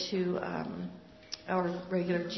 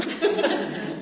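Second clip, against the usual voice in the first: short laugh; 10 semitones higher, 8 dB louder than usual. Real, not acted. Laughing at her own answer.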